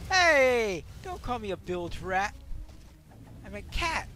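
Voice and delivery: loud indignant protest; indignant shout. Real, not acted. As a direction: yell back, offended. Player yells at game insult.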